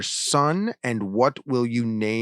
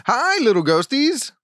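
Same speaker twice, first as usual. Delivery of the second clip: bright greeting; 12 semitones higher, 4 dB louder than usual. Acted.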